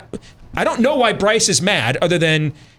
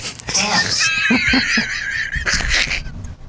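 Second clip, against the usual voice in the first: shrieking laugh. Real, not acted. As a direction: burst out shrieking with real laughter. Host reacts to listener's joke.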